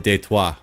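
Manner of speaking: Detroit said "day-twah", French-style; French spin. Shown as a demonstration. Explaining the local nickname to viewers, French-spun Detroit as "day-twah". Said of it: "put a French spin on it".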